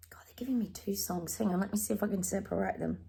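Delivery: whispered aside to herself; quiet aside. Real, not acted. Reader whispering to herself while picking songs. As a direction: hush it under your breath.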